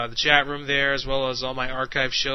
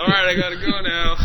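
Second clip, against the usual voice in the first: imitated old man; 4 semitones higher, 8 dB louder than usual. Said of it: "talking like an old man".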